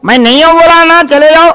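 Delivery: high, loud spoilt little nawab; bratty child voice. Acted. Naughty boy shouts at his tutor through the door, high and loud. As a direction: play the cheeky brat shouting from inside.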